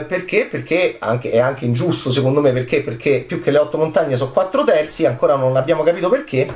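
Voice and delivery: ordinary talk, nothing marked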